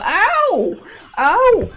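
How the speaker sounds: sharp cries of pain from a cramp; pained yelp. Real, not acted. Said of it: "I got a foot cramp".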